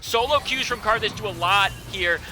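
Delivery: loud, high caster calling the fight; hype play-by-play. Real, not acted. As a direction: yell the ultimates as they land. Hype casting of a teamfight.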